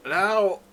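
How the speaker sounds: high, loud "ow"; acted jolt of pain; pained yelp. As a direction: yelp as you get up hurt. Character gets up in pain.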